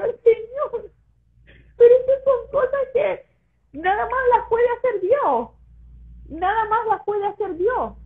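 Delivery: pleading to the Lord while crying; tearful plea. Retold or imitated. Retells her tearful answer to God. (súplica llorosa con la voz quebrada)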